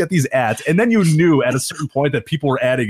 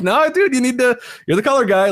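high-pitched, incredulous prodding; mock exasperated pleading; 8 semitones higher than usual. Announcer begging his colour guy for input, exasperated. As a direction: plead with him to do his job.

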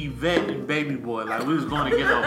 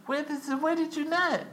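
high confused voice; 12 semitones higher, 4 dB quieter than usual. Retold or imitated. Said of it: "I'm like".

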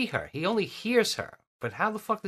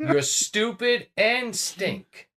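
slow taunt; 4 semitones higher, much slower than usual. Pretend. Taunting the pig slowly.

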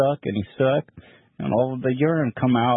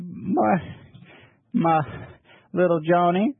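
pappy's voice; 7 semitones higher, slower than usual. Retold or imitated. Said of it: "He said".

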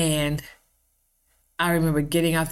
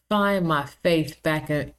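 ordinary talk, nothing marked

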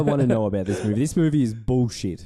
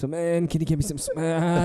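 high, hammed-up junkie voice; put-on high wheedling addict; whiny junkie; 5 semitones higher than usual. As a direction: beg like a desperate addict. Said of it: "just got some junkie in there".

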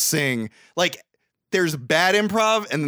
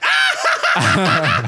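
a sudden high shrieking laugh, shouted; mock shrieking laugh; 6 dB louder than usual. Mimicking his cohost's laugh, a shrieking cackle. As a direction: burst into a wild squealing laugh. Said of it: "That's a great impression of your laugh".

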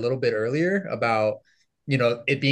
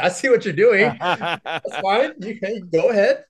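higher, amused voice with laughter; knowing professor; 7 semitones higher, 4 dB louder than usual. Retold or imitated. Professor letting a stubborn student read southern dialect, knowing and amused. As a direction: play the teacher who is on to you, chuckling. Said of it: "my professor's like".

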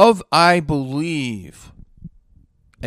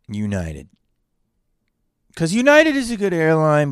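usual speaking voice

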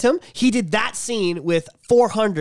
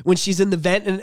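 ordinary talk, nothing marked

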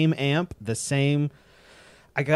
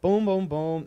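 mouth bass line; 7 semitones higher than usual. Retold or imitated.

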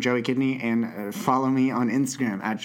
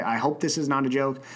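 ordinary talk

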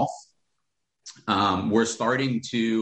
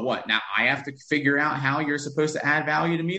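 ordinary talk

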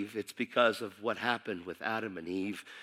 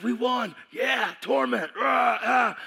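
gloating enemy; 10 semitones higher, 4 dB louder, slower than usual. Acted.

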